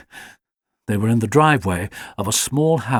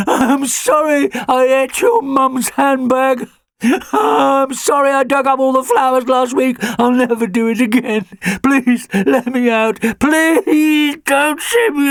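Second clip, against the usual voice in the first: desperate pleading dog; 14 semitones higher, 5 dB louder than usual. A narrator's reading. Alfie begs his family not to send him away, crying dog. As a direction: beg with everything left, voice breaking. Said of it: "barking himself hoarse".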